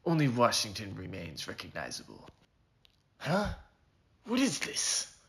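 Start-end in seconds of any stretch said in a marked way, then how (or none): 3.2-5.1 high, startled questions; jumpy and unsure, voice jumping up; nervous cowboy finding a note in his pocket. Acted. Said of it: "I asked nervously".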